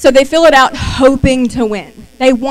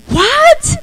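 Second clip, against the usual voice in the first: a very high, louder What; shocked squeal; 4 dB louder than usual. Retold or imitated. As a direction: yelp it in happy shock. Acting the stunned winner.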